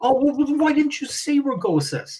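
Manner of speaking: high, mock-surprised customer voice. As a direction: play the customer suddenly getting it. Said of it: "customer voiced in anecdote".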